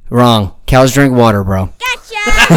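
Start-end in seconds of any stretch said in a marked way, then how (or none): 1.8-2.6 high gleeful laugh at the trick; triumphant laugh. Real, not acted. Dad laughing after tricking his coworker with a riddle. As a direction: laugh high and delighted at the gotcha.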